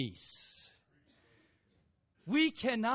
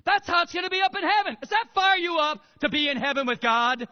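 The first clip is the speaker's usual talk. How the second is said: fiery preaching; 5 semitones higher, 11 dB louder than usual. Acted. Preacher rousing the congregation.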